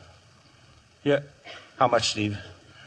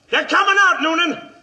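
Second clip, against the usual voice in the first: shouting out; 10 semitones higher, 5 dB louder than usual. Acted. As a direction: call it high and loud to the men outside. Shouting to the marshal outside.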